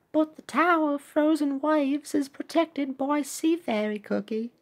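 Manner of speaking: high, soft little character voice; admiring crew member. Acted. Crew member praising the pirate captain, high admiring voice. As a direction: softly pipe up in a high voice.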